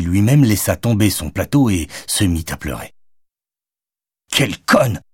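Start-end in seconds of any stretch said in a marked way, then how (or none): 4.3-5.0 annoyed. A narrator's reading. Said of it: "s'agaça Jonathan".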